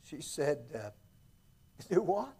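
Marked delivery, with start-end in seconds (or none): 1.9-2.3 high surprised question; lightly voiced country woman asking back. Helen voiced in story.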